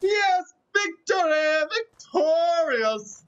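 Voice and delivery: high drawn-out victory call; slow, high, gloating victory cry; triumphant cheer. Real, not acted. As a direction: announce the win like a champion. Winning the match, celebrating.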